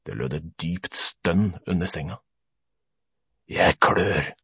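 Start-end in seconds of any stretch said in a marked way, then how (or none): 3.5-4.3 low, hoarse monster voice; deep, hoarse stranger's voice. A narrator's reading. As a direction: rasp it low from under the bed. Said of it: "sa en hes stemme".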